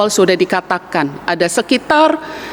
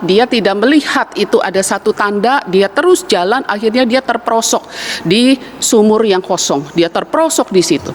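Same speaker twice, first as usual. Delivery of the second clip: usual speaking voice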